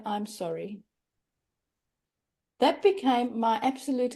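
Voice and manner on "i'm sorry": mock sympathy. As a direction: parrot the empty condolence softly. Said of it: "please don't say".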